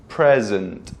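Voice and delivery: "present" as the noun, PREZ-ent; first-syllable stress. Shown as a demonstration. Teacher saying it two ways, "present" with front stress. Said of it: "say it two ways".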